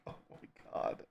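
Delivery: breathless whisper. Real, not acted. Viewer whispering after a laughing fit.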